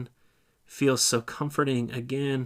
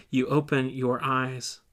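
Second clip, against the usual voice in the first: usual speaking voice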